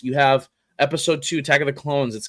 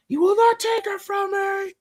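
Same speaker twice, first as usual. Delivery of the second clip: Anakin impression. Star Wars line reenacted.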